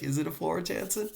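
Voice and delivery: high-pitched mock student question; eager student. Teacher imitates Rufus asking about a grade, high and eager. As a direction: imitate a keen pupil, pitch climbing to the end. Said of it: "You're like".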